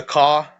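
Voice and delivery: CAW, car said with no R; R dropped. Shown as a demonstration. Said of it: "you lose the letter R".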